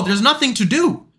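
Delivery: ordinary talk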